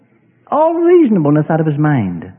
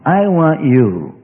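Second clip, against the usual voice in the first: stretched out slowly, yearning; slow tender longing; 3 semitones lower, much slower than usual. Acted. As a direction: draw each word out lovingly. Acted lovers' talk, slowed.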